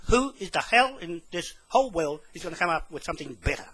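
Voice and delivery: usual speaking voice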